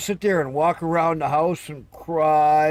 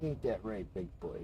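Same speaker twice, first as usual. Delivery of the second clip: quiet, low cooing to the cat; soft pet talk; 6 semitones lower, 14 dB quieter than usual. Real, not acted. Owner cooing to his cat, hushed and low. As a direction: coo at the cat softly.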